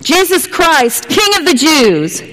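high, slow proclamation of the sign; herald calling out. Retold or imitated. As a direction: announce it like a town crier.

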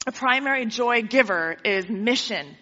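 usual speaking voice

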